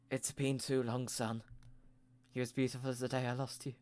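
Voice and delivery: soft, hushed fatherly tenderness; tender father. Acted. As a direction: say it gently to a long-lost son, then rush the last line. Panda dad greets lost son.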